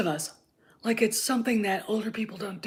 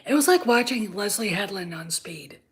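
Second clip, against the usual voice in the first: usual speaking voice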